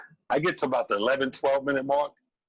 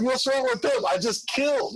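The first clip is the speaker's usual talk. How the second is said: proud disbelief; 9 semitones higher than usual. Retold or imitated. Acting proud self after set.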